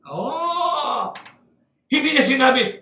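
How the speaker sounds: ordinary delivery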